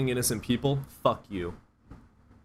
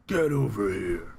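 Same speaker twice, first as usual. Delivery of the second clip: slow deep game announcer growl; Mortal Kombat announcer; 3 semitones lower, slower than usual. Retold or imitated. Imitating the game voice for the chat. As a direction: drag it out like the fighting game voice. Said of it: "I wish I had a deep voice".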